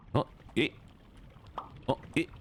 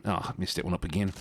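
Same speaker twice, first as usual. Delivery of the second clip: normal talking voice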